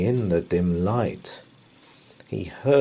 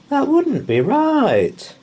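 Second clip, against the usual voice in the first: mumi merengek; 5 dB louder, slower than usual. A narrator's reading. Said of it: "wailed the mummy".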